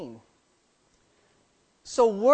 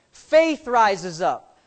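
slow emphatic preaching; 7 dB louder, much slower than usual. Shown as a demonstration.

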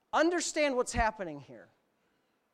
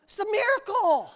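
higher-pitched retold amazement; overjoyed; 8 semitones higher, 4 dB louder than usual. Preacher recalls his joy at the miracle, overjoyed. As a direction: marvel out loud, high and happy. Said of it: "I was so happy".

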